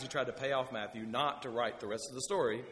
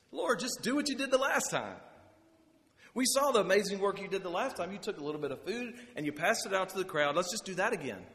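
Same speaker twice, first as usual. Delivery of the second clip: higher, mildly whiny voice; eager disciples; 6 semitones higher, 4 dB louder than usual. Acted. Disciples urging Jesus with a lightly voiced high whine. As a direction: play the disciples lightly, half-pleading.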